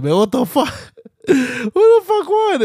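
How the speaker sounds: just woke up, can't believe he missed the fight, laughing shout; laughing disbelief. Retold or imitated. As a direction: shout it while laughing at yourself. Woke up to everyone celebrating.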